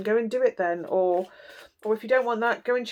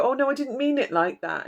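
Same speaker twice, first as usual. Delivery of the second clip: mock innocent backpedal; 5 semitones higher than usual. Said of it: "and then you go".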